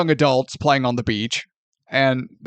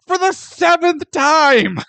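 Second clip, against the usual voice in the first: exasperated outburst; 13 semitones higher, 4 dB louder, slower than usual. Real, not acted. Exasperated recap of repeated abandonment.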